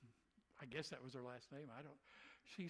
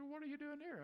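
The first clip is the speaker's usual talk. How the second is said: much higher, startled what-are-you-doing-here; baffled question; 12 semitones higher, 4 dB louder, faster than usual. Retold or imitated. Stranger at the bar asking the preacher, high and startled. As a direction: sound caught off guard.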